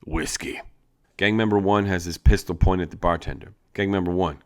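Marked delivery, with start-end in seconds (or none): 0.0-0.6 low, soft breathy grumble; a quiet gunslinger's grumble; grumbled. Acted. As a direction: growl the order under your breath. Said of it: "tips up his hat and grumbles".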